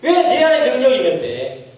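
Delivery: ordinary talk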